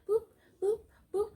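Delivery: high, short register beeps; high-pitched cashier scanner noises; imitated scanner boops. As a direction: boop like a checkout scanner.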